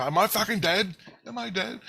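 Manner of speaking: high-pitched retold panicked question; mock alarm. Asking Christ after that heart attack, high acted alarm. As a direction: ask it high, as if startled by the jolt.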